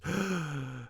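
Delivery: sharp high intake of breath; awed gasp. Acted. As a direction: gasp in awe at the passing procession. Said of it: "soon she was gasping too".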